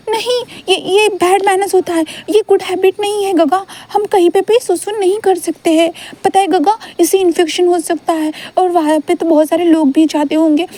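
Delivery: put-on high, fussy little-boy voice; a small boy scolding about bad manners; prim child. Wing refuses to pee behind a tree, high prim child voice.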